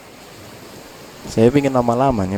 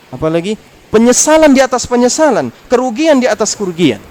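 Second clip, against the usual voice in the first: loud, much higher cry of regret; impassioned preaching; 9 semitones higher, 6 dB louder than usual. Acted. Preacher warning listeners of hell, loud and higher. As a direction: hammer the regret home, voice raised.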